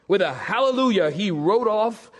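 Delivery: normal talking voice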